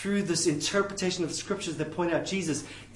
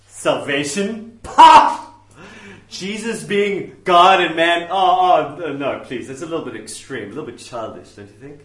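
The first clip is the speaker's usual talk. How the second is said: loud mock shock fading to polite disdain; hammed-up scandalized clergyman; dismissive liberal minister; 9 dB louder than usual. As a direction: scoff loudly, then soften into condescension. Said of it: "This is what you'll get from one of those guys".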